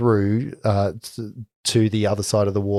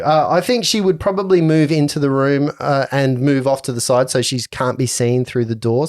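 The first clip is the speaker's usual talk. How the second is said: ordinary delivery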